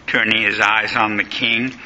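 ordinary delivery, nothing marked

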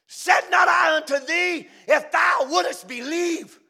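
slow, loud preaching voice of Jesus; booming rebuke. Acted. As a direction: thunder the rebuke out slowly.

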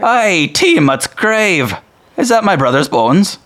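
slow, high, loud dwarf disbelief; startled dwarf. Acted. Dwarf sees his brother's finger bone, slow and loud. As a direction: stretch it out in shocked disbelief.

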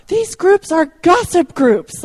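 high, slow, loud mock yell; imitated yell. Woman disrupts a women's workshop in front of everyone, imitated yell. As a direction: blurt it out loudly, drawn out, as an imitation. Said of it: "She yells out".

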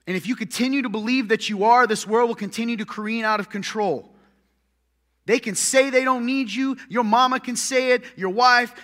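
fiery preaching. Acted. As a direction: preach it hard to the congregation. Preaching at full force.

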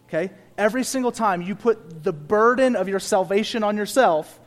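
usual speaking voice

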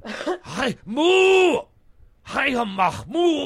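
put-on loud, high German-accented moo; German cow. Asked how foreign cows sound, loud German cow. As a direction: bellow it like an angry German cow. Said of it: "Germans would be like".

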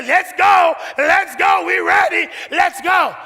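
high, loud eager call to friends; excited rallying. Acted. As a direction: hurry everyone out, thrilled. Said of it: "get all her girlfriends and say".

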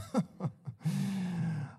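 soft self-satisfied laugh; mock pastor laugh; smug chuckle. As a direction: chuckle at your own greatness. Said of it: "When you hear people talk like this".

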